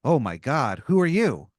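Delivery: rising, startled and loud on who are you. Acted. Sumo wrestler startled by the newcomer, alarmed question. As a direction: jump in surprise at the stranger. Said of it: "he goes".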